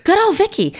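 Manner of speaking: put-on high fond echo of good old Vicky; good old Vicky, high and playful; mock fond. Echoing with affection.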